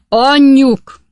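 very loud, high yell; high-pitched, full-force shout; big bellow. A narrator's reading. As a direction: holler for the wife to come out. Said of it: "ordított egy nagyot".

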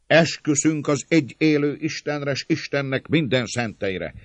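high, loud declaration; solemn oath. A narrator's reading. Attacker swearing safety to servants, loud oath. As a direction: swear it out for all to hear. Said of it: "Amaz esküre fölemelvén kezét".

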